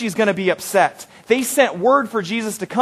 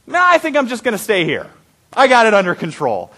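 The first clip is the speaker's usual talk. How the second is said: higher, breezy brush-off; mock-casual refusal; 4 semitones higher, 4 dB louder than usual. Preacher playing Jesus shrugging off a dying friend, mock-casual. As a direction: wave it away, a bit pitched up.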